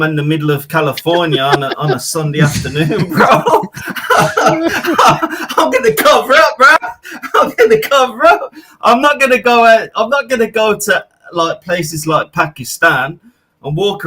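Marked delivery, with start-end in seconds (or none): 3.1-6.8 high giggly laugh through the words; real amused laughter bubbling through. Real, not acted. Laughing about his travel plans. 7.4-11.1 laughing. Real, not acted. Still laughing, raised voice.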